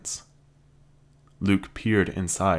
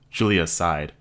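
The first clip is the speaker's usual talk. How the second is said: ordinary delivery, nothing marked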